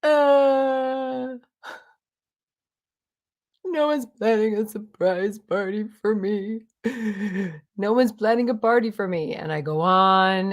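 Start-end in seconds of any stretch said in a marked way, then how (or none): 0.0-9.3 a mock whimpering complaint; whiny self. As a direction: whine like a sulking kid. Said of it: "and I'm whiny".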